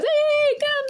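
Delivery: mimicked high squealed hail; squeaky mock calling-over; high-pitched call. Acted driver calling to her.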